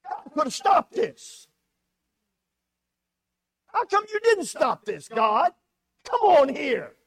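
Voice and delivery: loud, high angry demand of God; railing at God. Acted. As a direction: shout at God as if betrayed. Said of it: "He's railing at Jesus".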